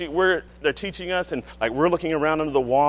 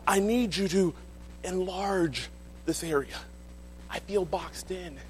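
pleading, rising then fading to a hush; pleading prayer; 4 semitones higher than usual. Acted. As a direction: beg God, then drop almost silent. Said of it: "David is saying".

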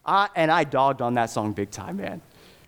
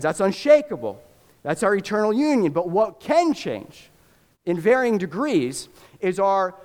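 high, ringing sermon declaration; emphatic preacher at full force, raised pitch; impassioned preaching; 7 semitones higher than usual. Real, not acted. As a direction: proclaim it to the whole congregation with rising conviction.

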